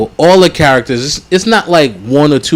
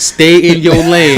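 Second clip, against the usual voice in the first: drawn-out poster slogan voice; slow slogan read. Retold or imitated. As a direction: say it like a motivational poster. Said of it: "Have something like that".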